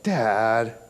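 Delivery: drawn-out, low, loud "Dad"; mock protest. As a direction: imitate a child's protesting groan of a word. Daughter's protest, retold.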